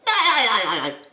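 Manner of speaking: very high, loud, rattling cartoon laugh; loud staccato squeal of put-on laughter; SpongeBob laugh. Shown as a demonstration. Coach demonstrating the easy laugh, SpongeBob cackle. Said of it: "So the laugh is simple".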